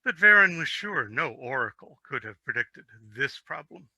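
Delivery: ordinary delivery, nothing marked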